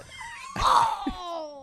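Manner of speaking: high, squealing burst of laughter; a yelp of delighted laughter; shrieking laugh. Real, not acted. Caught out over the Chinese laundry, shrieking laugh.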